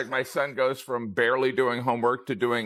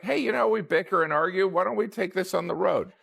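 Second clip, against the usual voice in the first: higher, sarcastic cheerful suggestion; sarcastic pitch; 7 semitones higher than usual. Retold or imitated. Dad sarcastically pitching a family trip, higher and breezy. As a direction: sell the disaster trip brightly. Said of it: "It's kind of like".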